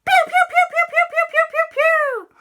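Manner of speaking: loud, squealing rapid fire; laser zaps. Acted. Mummy fires her charged phone, high laser noises. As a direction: squeal a long barrage.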